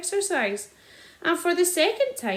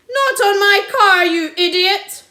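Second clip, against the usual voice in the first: high, loud furious bellow; 8 semitones higher, 13 dB louder than usual. A narrator's reading. Landowner bellowing at the sergeant over his car. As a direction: roar at the idiot. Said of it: "Mister Hazel bellowed".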